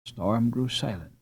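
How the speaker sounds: ordinary delivery, nothing marked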